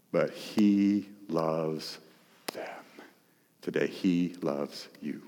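slow, soft, heartfelt; tender. Real, not acted. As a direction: let it land gently, slowly. Tender climax about Jesus' love.

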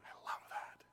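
breathy whispered aside; preacher whispering his delight to the church. Real, not acted. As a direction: whisper it like a secret. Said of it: "whispered aside".

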